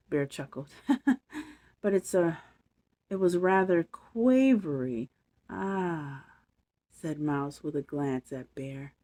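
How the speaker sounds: put-on lively bedtime story read to kids; story narration. A narrator's reading. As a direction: read it like a storybook for little ones. Reads bedtime story aloud.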